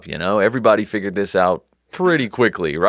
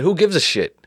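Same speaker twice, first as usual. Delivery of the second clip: dismissive Nuzzo; 7 semitones higher than usual. Retold or imitated. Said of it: "Nuzzo said".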